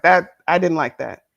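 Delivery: ordinary delivery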